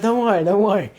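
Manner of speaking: reassuring call. Retold or imitated. Calming friend over dog chase.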